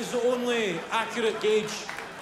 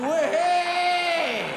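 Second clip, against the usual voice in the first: high, loud, drawn-out mock cheer; jeering cheer; 7 semitones higher, 5 dB louder than usual. Workman jeering from a van at a fallen man, jeering cheer. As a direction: whoop a long jeer at someone who fell. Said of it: "A full-on wanker sign going".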